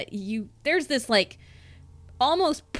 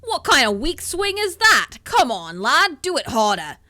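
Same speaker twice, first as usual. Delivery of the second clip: loud mocking challenge, higher; gruff ogre taunting; 7 semitones higher, 8 dB louder than usual. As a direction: taunt him to swing harder. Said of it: "he kinda goes".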